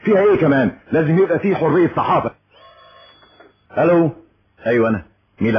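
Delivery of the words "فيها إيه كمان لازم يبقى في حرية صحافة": defiant outburst. Acted. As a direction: raise the voice in open defiance. Defies being told to lower voice.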